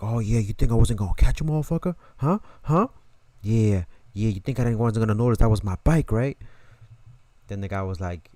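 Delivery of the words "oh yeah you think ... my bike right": loud, taunting confrontation; angry bike owner. Retold or imitated. Bike owner yelling at the thief, loud and taunting. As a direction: catch him red-handed and rub it in.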